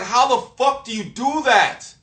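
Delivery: high, loud, drawn-out incredulous question; exasperated disbelief. Retold or imitated. Speaker confronts the one parroting him, exasperated disbelief. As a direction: retell it with loud, slow exasperation.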